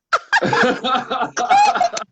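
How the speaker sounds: very high, loud cackle; helpless squealing laugh at your own joke; burst of laughter. Real, not acted.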